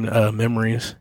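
normal talking voice

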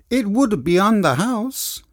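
high, slow, flirty Italian purr; flirtatious Italian accent. Acted. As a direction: charm her with a slow Italian come-on. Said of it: "in that flirtatious tone".

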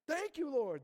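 loud, high cry of thanks; exclaimed thanks. Real, not acted. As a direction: call out to God in relief. Calls out thanks to God.

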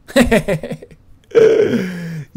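big laugh. Real, not acted. Laughing after the scream.

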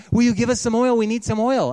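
pleading bridesmaids. Acted. Foolish bridesmaids begging for oil.

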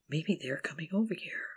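scared whisper, rising at end. Acted. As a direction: whisper it like they might hear. Fears someone is coming.

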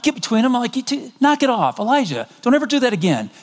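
high, commanding dad voice, scolding; stern dad breaking up a fight. Retold or imitated. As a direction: play the dad telling the boys off. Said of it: "I'm the dad".

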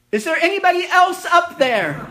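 high, loud call with a laugh; hammed-up punchline shout. Punchline, man calls again.

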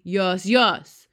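very loud giant's insistence; booming. A narrator's reading. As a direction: boom it back at him. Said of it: "boomed the blood bottler".

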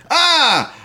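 mock scream. Mock scream at shower scare.

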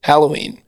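Halloween with the short "a" of Hal; first syllable like Hal. Shown as a demonstration. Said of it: "I say Hal".

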